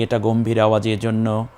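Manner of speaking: normal talking voice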